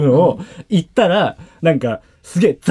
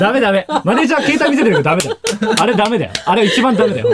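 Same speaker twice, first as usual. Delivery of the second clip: high, laughing objection; amused, raised-pitch telling-off; laughing protest; 4 semitones higher, faster than usual. Real, not acted. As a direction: protest through real laughter. Laughing protest at leaking his number.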